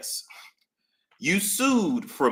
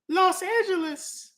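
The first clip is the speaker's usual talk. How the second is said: high airy imitation of a young woman. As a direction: put on a valley girl voice for the witness.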